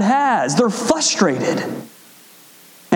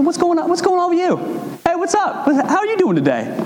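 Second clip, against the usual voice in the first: high, bright friendly greeting; cheery Mister Zen; 10 semitones higher, faster than usual. Retold or imitated. Relaxed shopper greeting a neighbor in line, high and cheery. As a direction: chirp it like the happiest shopper. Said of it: "I'm like Mister Zen".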